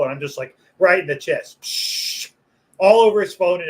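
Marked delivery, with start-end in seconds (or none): 1.6-2.3 whooshing imitation of spraying water; hose spray noise. Butcher hosing the kid in the chest, spray noise. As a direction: make a hissing burst of water.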